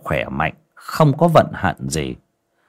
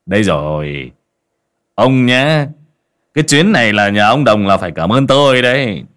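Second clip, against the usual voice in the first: louder, rougher braggart voice; boastful scarred man; 2 semitones higher, 4 dB louder than usual. A narrator's reading. Scarred man bragging to the sick old man, loud and rough. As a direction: crow about the favour you're doing.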